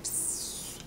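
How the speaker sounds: soft airy hiss; quiet gas noise. Shown as a demonstration. Demonstrating the quiet kind of gas, soft hiss. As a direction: let out a barely audible psst. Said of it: "quiet ones that".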